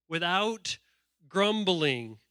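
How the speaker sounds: "without grumbling" very slow, each word drawn out; underlined emphasis. Shown as a demonstration. Preacher underlining scripture for the congregation, "without grumbling" slow and deliberate. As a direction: stretch "without", pause, then land "grumbling". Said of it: "What does it say and underline?".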